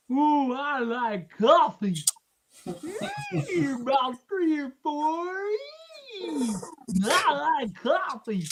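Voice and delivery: mock dreamy coffee lover; goofy put-on voice. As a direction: savor it like a silly cartoon character.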